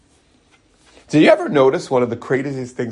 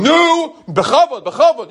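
high, loud, impatient shout for respect; jaded yell at the cantor; 11 semitones higher, 4 dB louder than usual. Retold or imitated. A jaded worshipper yelling at the prayer leader, impatient loud shout. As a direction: bark at the prayer leader to show honor. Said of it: "to scream at the Baal Tefillah".

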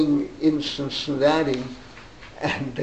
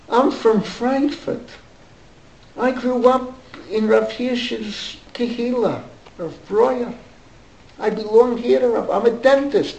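put-on higher, German-accented old man; lightly voiced German immigrant, higher; 7 semitones higher than usual. Dentist telling the boy about Frankfurt, German accent. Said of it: "with a German accent".

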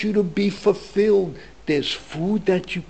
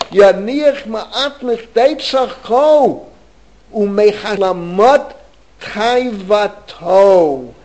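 text reading; 5 semitones higher, 8 dB louder, slower than usual. Shown as a demonstration. Reads source text aloud.

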